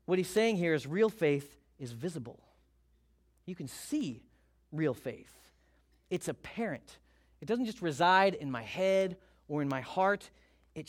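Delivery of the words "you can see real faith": ordinary talk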